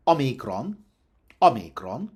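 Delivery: Omicron as O-mee-kron, short O stressed, long ee; ancient Greek pronunciation. Shown as a demonstration. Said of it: "let's try and do ancient Greek pronunciation".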